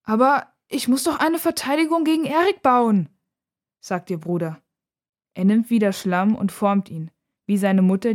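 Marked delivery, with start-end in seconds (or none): 0.0-3.1 put-on high-pitched childlike protest; indignant, high-voiced objection. A narrator's reading. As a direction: plead your case as a little brother. Boy protests to scolding sister.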